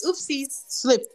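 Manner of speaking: high, slow fake-innocent singsong; mock-innocent. Girl who pushed her off the slide, mock innocence.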